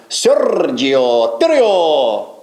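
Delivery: Sergio Torrio with long trilled Rs, very drawn out; rolled Rs. Shown as a demonstration. Host comparing the name's sound, exaggerated rolled Rs. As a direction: roll the Rs hugely in Sergio and Torrio. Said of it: "It's like saying".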